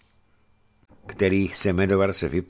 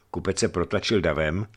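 ordinary delivery

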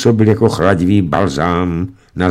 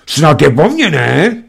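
raised, testy retort; irritated; 8 semitones higher, 4 dB louder than usual. A narrator's reading. Wife retorting to her husband, irritated. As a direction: bite back at the husband. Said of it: "ptala se podrážděně".

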